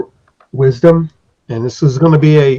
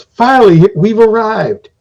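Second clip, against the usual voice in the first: eager guide; 8 semitones higher, 5 dB louder than usual. Acted. GM voicing guide arriving.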